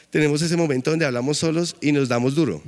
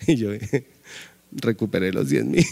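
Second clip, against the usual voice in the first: laughing. Real, not acted. Tells getting the money back.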